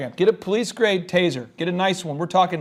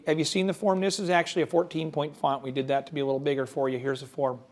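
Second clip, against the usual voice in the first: soft, low, fast clerk mumble; quiet; 5 semitones lower, 8 dB quieter, faster than usual. Retold or imitated. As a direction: mumble it down to nothing. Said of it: "where you go".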